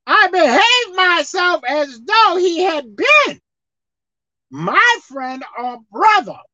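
high, loud, slow reading of the verse; slow emphatic scripture; preached scripture reading. Acted. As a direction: proclaim the verse.

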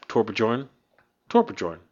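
"Torbjorn" sounded out with an English J, tor-buh-JORN; mistaken pronunciation. Shown as a demonstration. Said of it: "how do you pronounce this".

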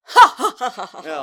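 loud fake laugh; mocking laugh. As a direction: cackle at her mockingly. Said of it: "Red responds".

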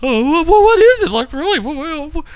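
very high, stammering squeal, imitating her; hysterical girlfriend. Imitating girlfriend discovering the thrown ring, hysterical squeal. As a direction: squeak it out as if helpless with laughter. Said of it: "she keeps laughing".

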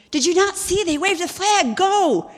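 high, loud incredulous yell; frantic urging. Retold or imitated. Friend yelling at the motionless jouster, frantic urging. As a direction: shout at him to get moving.

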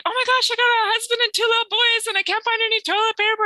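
high, rushed panic over the toilet paper; frantic. Retold or imitated. Neighbor moving in, panicking about toilet paper, high frantic voice. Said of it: "She was frantic".

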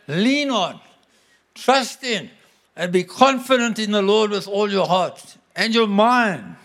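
preaching scripture. Acted. Reading the Amplified Bible aloud.

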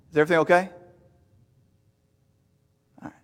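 ordinary talk

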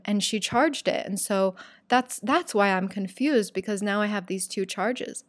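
usual speaking voice

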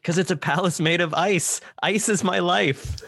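high-pitched acted movie quote; Kristoff. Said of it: "what is Kristoff's reason for wanting to come".

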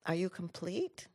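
soft, measured question; very calm. Retold or imitated. Mother to the principal after his lecture, very calmly. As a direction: ask it quietly, with total composure. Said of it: "She very calmly says".

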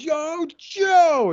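a high, hammed-up call of the hero's name; mock shout. Cover Girl calls out to Joe in the pitched movie, mock shout. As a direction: call it out like an action-movie heroine. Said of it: "She goes".

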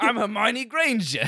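slow, hammed-up Cockney schoolgirl; slow mock Cockney, playful. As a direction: ham up a Cockney accent. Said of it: "That's how she sounds".

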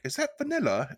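high prim question, voiced by a friend; mock judge voice.

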